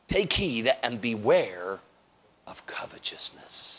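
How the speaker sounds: slow, dropping to a whisper; slow hushed warning trailing into a whisper; fading to a whisper. Retold or imitated. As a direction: let it die away into a whisper. Scripture quote fading to whisper.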